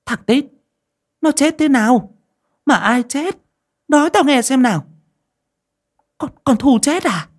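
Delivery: put-on high, loud demanding old woman; pressing questions. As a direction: fire questions at the servant. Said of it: "hỏi dồn".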